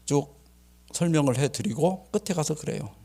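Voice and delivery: ordinary delivery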